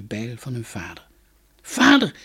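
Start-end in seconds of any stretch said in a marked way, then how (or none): 1.6-2.1 shouted high and loud into the woods; calling out. Acted. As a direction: call out to a lost father. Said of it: "riepen ze". (luid en hoog roepend)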